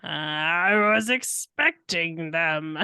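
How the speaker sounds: slow, low nefarious villain voice; Doctor Egg guy. Acted. Player repeats the faked voice, slow and nefarious. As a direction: draw it out with sinister relish. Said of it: "in a nefarious Doctor Egg guy voice".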